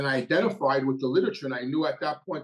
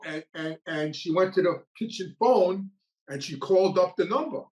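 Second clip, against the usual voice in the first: ordinary talk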